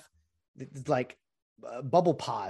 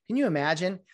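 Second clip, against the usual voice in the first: ordinary talk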